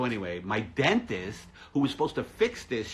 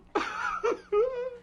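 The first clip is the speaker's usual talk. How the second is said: hammed-up wail. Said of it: "Wolf is just crying".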